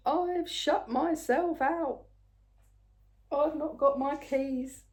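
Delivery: high, slow, mildly panicked plea; panicky neighbour. Retold or imitated. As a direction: retell a flustered neighbour locked out. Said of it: "in a very panicky voice".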